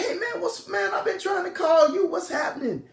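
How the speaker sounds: very high excited mock female voice; acted-out high-pitched phone greeting; imitated girlfriend. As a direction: squeal it lightly like a relieved caller. Girlfriend finally reached by phone.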